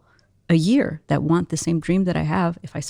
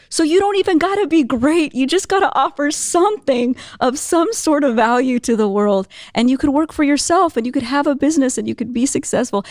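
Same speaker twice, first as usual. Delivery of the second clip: high, impassioned encouragement; emphatic pep talk; 8 semitones higher than usual. Retold or imitated. Speaker encouraging young lady, high and emphatic. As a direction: urge her on with rising conviction.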